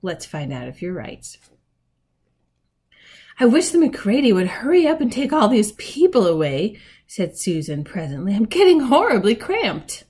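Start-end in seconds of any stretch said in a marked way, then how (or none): 3.4-6.8 put-on higher, louder fretful child's grumble; impatient complaint. A narrator's reading. Susan complains in the cramped wardrobe. 8.3-10.0 higher and louder, fretful; cramped complaint. A narrator's reading. Susan complains of being cramped.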